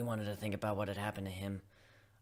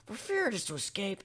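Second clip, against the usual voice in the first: plain reading voice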